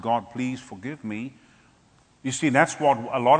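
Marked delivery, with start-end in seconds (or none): 0.0-1.3 lower, quiet, slow remorse; a touch of weeping as you pray; tearful. Retold or imitated. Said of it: "I started weeping".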